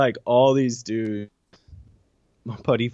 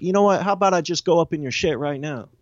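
retold angry challenge, higher voice; 7 semitones higher, faster than usual. Inmate squaring up to an officer, angry retold threat. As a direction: push it up high and confrontational. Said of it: "just the angry".

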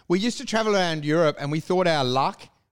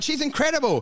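mock approval; 8 semitones higher than usual. Feigned approval to the mate.

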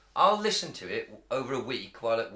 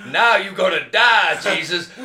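loud, slow, laughing cowboy drawl; 8 semitones higher, 14 dB louder than usual. Retold or imitated. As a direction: belt it like a country singer. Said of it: "it's talking in a cowboy voice".

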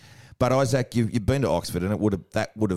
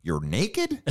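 higher-pitched, incredulous question; shocked misunderstanding; 6 semitones higher than usual. Acted. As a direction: mimic the friend's scandalised confusion. Acting friend misunderstanding the slang.

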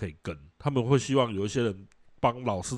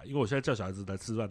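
ordinary delivery

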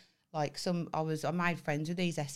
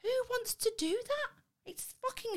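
shocked disbelief. Real, not acted. Outraged disbelief at kids.

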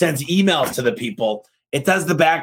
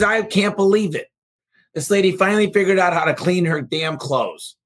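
crabby lady; 6 semitones higher than usual. Acted. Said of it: "pretty crabby lady".

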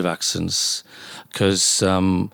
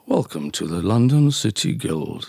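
put-on formal London accent; 5 semitones lower than usual. Doctor shows off a formal accent, low London voice. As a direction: welcome guests in a lower, measured London accent. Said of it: "I could do a very corporal".

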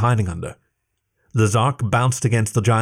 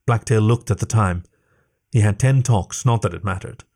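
ordinary delivery

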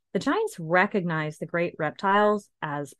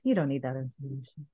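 low, fast brain voice; a quick low inner voice; 5 semitones lower, 6 dB quieter, faster than usual. Retold or imitated. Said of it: "and my brain goes".

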